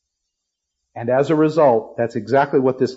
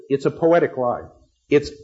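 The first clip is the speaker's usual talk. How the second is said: normal talking voice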